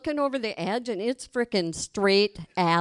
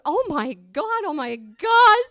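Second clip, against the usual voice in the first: slow, higher, rising into a loud panic; mock panic growing louder; panicked oh my God; 6 semitones higher, 8 dB louder, slower than usual. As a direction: gasp it out like she's terrified. Friend panics after the ledge.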